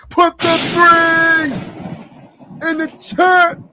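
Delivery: high, drawn-out call to the chat; slow hype shout. Real, not acted. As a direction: stretch every word like a stadium chant. Hyping chat after a three.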